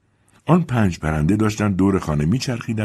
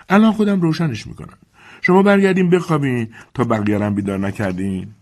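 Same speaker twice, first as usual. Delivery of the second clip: put-on high, raised old servant voice calling upstairs; Dilsey calling up; 7 semitones higher, 4 dB louder than usual. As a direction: call up the stairs to the mistress.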